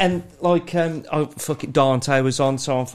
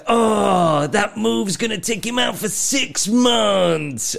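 high, slow, loud dramatic call; wrestling commentator; 7 semitones higher, 3 dB louder, much slower than usual. Retold or imitated. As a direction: stretch it into a grave ringside exclamation. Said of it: "the way that he'd be like".

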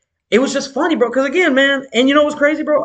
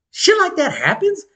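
disbelieving; 9 semitones higher, slower than usual. Real, not acted. Disbelief at the story.

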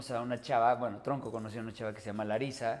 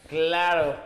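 louder, higher laugh after the word; 4 semitones higher, 10 dB louder than usual. Real, not acted. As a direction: burst out laughing at the question. Reacting to a cheeky question.

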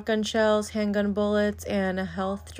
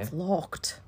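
lower, softer British imitation; British accent; 5 semitones lower, 7 dB quieter than usual. Imitating Harry at the locked door, British accent. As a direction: echo it in a put-on British accent. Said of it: "Harry was kind of British".

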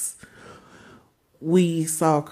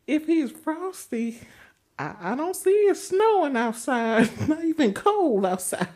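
much higher, louder, sassy; mocking high voice; 7 semitones higher, 4 dB louder than usual. Mocking the owner about the dog's name, high sassy.

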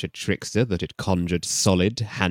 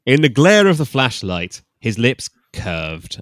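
dramatic slow reading; 4 semitones higher, 7 dB louder than usual. Shown as a demonstration. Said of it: "repeats narration dramatically".

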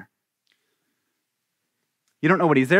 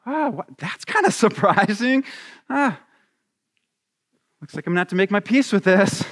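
lightly acted meek wife, hesitant; half-hearted, awkward surprise; mock mild surprise; 4 semitones higher than usual. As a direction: pretend to put up with it, haltingly. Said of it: "Let's try this on".

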